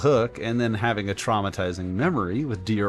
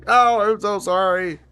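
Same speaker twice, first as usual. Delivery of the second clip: higher and louder pleading sorry; louder, more frantic apology; 8 semitones higher, 6 dB louder than usual. Retold or imitated. Apologizing to the worm again, higher and louder, pleading. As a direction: beg the worm's forgiveness, now louder. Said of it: "I was just like".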